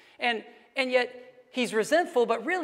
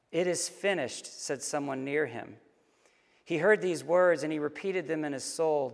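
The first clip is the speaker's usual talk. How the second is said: grave narration, sinking lower; solemn story reading; 4 semitones lower than usual. A narrator's reading. Reading the man's final hours to listeners, solemn narration. Reads the death scene.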